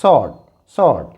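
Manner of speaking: "sword" said as "sord", W not sounded; "sword" said low and firm with a silent W. Shown as a demonstration. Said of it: "W silent है". ("sword" में W silent, "सोर्ड")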